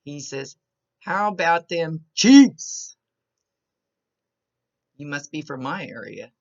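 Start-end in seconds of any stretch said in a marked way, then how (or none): 1.0-3.0 loud, drawn-out team holler; big sports-fan shout with a long CHIEFS; rousing fan cheer. Retold or imitated. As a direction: belt it out slowly, stretching CHIEFS. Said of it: "Let me do that a little better".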